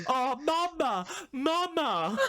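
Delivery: imitating a fan fake-sobbing; wailing for his mother in mock despair; mock crying. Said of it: "pretending to cry".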